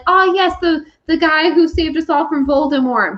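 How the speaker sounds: high-pitched performer in character; hammed-up in-character recognition; acted park character. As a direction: play it up high as a theme-park wizard. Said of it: "You're allowed to, like, know of them".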